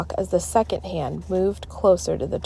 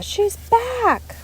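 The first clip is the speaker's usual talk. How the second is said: panicked. A narrator's reading. Alexia panicking.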